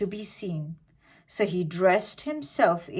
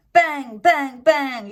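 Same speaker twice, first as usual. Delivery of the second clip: loud, higher bangs; loud banging; 7 semitones higher, 8 dB louder, slower than usual. A narrator's reading. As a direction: hammer the word like knocks. Banging on the door.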